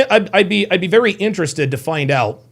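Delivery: ordinary talk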